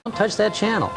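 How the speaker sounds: ordinary talk, nothing marked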